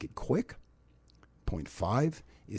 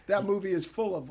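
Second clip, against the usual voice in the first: laughing; 7 semitones higher than usual. Real, not acted. Laughing after co-host's joke.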